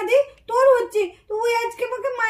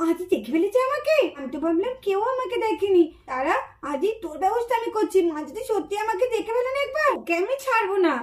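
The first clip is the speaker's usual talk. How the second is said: hushed muttered threat; 7 dB quieter than usual. Acted. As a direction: mutter it under your breath. Hiding child mutters threat. (চাপা গলায় রাগে বিড়বিড় করা)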